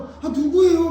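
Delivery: impatient disciple, loud and high; Peter. Retold or imitated. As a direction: blurt the question out loudly, high-pitched. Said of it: "그리고 막 베드로는".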